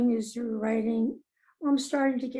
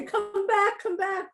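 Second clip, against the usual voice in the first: mock pleading; 10 semitones higher than usual. Pleading with the departed muse.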